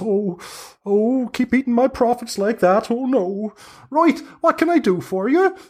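put-on high squeaky old hag calling down from the trees; high-pitched busy hag. Hag finds the bottle empty.

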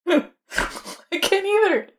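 high, slow laughing words. Real, not acted. Laughing at friend's bad idea.

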